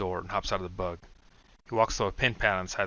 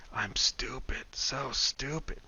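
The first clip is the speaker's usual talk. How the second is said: soft whisper slowing down; whispered self-reproach; 4 semitones higher, 4 dB quieter, much slower than usual. Acted. Brian scolding himself in his head, slowing whisper. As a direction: berate yourself under your breath.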